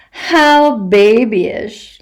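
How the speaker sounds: a mild wicked chuckle; mocking laugh. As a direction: drag it out to mock the child. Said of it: "laughs the voice".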